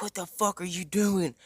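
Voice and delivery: outraged mom's yell, not full volume; mock screaming. Friend's mom bursting in on the boys, mock screaming. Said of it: "she's immediately screaming".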